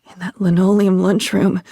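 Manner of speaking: ordinary talk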